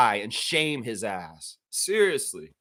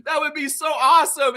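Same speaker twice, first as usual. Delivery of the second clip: high, loud fake-cheery voice; mimicking Ed Rogers gushing, high and loud; mock fawning exec; 12 semitones higher, 6 dB louder, slower than usual. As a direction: put on a gushing suit. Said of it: "It's like".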